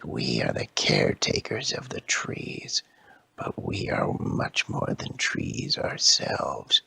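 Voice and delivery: slow, hushed tree voice; hushed ancient Ent; whispery tree creature. Acted. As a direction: speak like an old whispering tree. Another Ent speaks.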